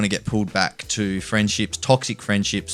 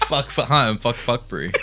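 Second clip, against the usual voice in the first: laughing; 3 semitones higher than usual. Real, not acted. Laughing mock intro.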